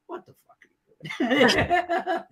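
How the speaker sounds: high, slow, laughing disbelief; shocked outburst. Retold or imitated. Retired star startled by FaceTime prank, high laughing shock.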